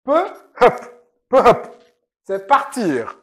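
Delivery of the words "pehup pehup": "pehup" with a breathed h in the middle; "pehup", h breathed out between the syllables; dialect pronunciation. Shown as a demonstration. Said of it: "nous disons pehup". ("pehup" avec un h soufflé au milieu)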